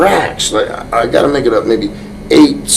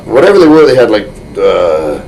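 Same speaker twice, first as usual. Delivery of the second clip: normal talking voice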